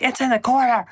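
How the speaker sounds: high, loud panicked call; frightened cry. Retold or imitated. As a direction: shout the warning in fear. Screaming while seeing hallucination.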